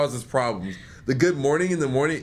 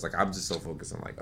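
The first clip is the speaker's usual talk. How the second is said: ordinary talk, nothing marked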